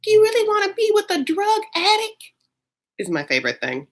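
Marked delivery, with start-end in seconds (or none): 0.0-2.2 pitched-up Southern mother asking about the drug addict; Alice saying drug addict. Retold or imitated. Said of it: "the way Alice says drug addict".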